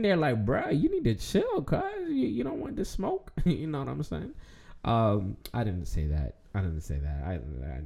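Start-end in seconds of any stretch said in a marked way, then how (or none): 0.4-3.3 lightly voiced teacher, high and casual; imitating a smug teacher, pitched up; mock teacher voice. Quoting history teacher, a high mock voice.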